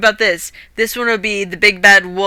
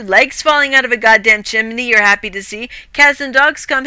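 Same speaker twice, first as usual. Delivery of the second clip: ordinary delivery, nothing marked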